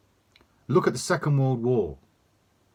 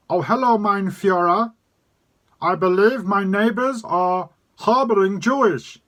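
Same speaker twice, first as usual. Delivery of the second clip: high, loud, slow mock German accent; a hammed-up German accent, much higher and louder; bad German accent; 9 semitones higher, 6 dB louder than usual. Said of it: "That was a real bad impression and, and accent".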